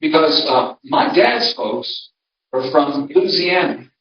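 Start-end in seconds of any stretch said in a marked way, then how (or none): 3.1-3.9 LOUISIANA run together as LOO-zee-ANN-uh; local Louisiana pronunciation. Shown as a demonstration. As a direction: say Louisiana the local way, as Loozy-anna. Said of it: "that's how they say it in Louisiana".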